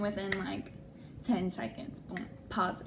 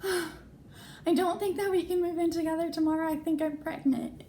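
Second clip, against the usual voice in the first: higher, shaky-toned admission; anxious confession; 8 semitones higher than usual. Retold or imitated. Telling her new roommate she might be pregnant, anxious. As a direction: confess it carefully, a little scared.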